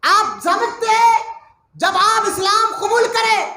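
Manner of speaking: high, loud and slow, wailing in grief; a hurt friend crying out his love; weeping plea. Acted. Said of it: "حضرت عمر رونے لگے".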